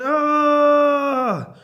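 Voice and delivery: high, loud drawn-out "aah!"; falling scream. A narrator's reading. Witch knocked off her broom, high scream. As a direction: scream as you plunge from the sky.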